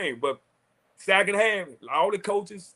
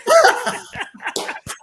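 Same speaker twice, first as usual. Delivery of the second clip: delighted high laugh; 6 dB louder than usual. Real, not acted. Delighted at surprise caller.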